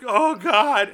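high, loud exclamation; retold overwhelmed reaction. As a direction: cry out as if overwhelmed.

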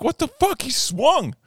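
high, outraged shout at the umpire; furious yell. Retold or imitated. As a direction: scream the protest, pitch way up. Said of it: "You know, I start yelling".

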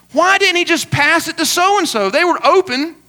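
mock incredulous sideline griping; armchair complaint. Someone watching football grumbles about a player's pass, exasperated.

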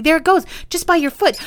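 high, quick, excited alert; shouted excitedly. A narrator's reading. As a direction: point it out in a rush. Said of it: "he shouted excitedly".

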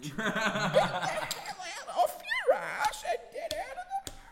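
tiny falsetto screech, held back in volume; drawn-out, squeezed mock scream; mock screaming banshee. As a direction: imitate a banshee yelling, slow and sky-high. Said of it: "turn into a screaming banshee and just start yelling".

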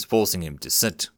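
ordinary delivery, nothing marked

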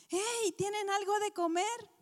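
high, loud call across the water; a friendly hail from the beach. Acted. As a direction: shout it out to the boat from shore. Jesus calling across water.

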